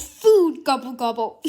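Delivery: loud, hungry monster voice; hungry whale. Acted. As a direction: gobble like a greedy beast. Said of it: "the whale goes".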